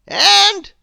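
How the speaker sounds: high, loud, slow wizard voice; stern wizard. A narrator's reading. Wizard scolding Mister Mischief, stern and loud. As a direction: loom over the culprit as a stern wizard. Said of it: "he went on".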